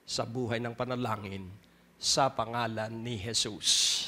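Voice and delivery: prayer. Acted. Pastor leads a prayer. (mahinahong panalangin)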